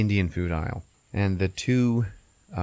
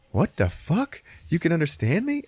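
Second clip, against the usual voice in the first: higher, incredulous question; raised-pitch disbelief; astonished Barry; 9 semitones higher than usual. Retold or imitated. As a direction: ask it in mild mock shock. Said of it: "Barry's like".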